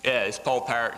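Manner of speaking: ordinary talk, nothing marked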